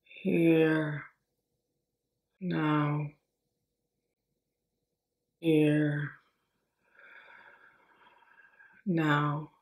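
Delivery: slow, spacious meditation voice with long pauses; guided breathing meditation. Acted. Teacher pacing a group's breath, calm guided meditation. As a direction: guide the breath softly, word by word.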